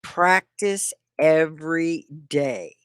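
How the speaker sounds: slow, word by word; deliberate, spaced-out words; slow teaching model. Shown as a demonstration. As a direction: spell it out slowly for learners. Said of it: "Am I talking slow enough?".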